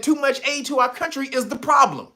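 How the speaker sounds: high, firm quoted statement; quoting the president. Retold or imitated. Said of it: "He said".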